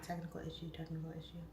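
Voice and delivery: quiet throwaway repetition; muttered aside. Retold or imitated. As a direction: drop the volume for a mock alert. Quotes the stream's mishaps, aside.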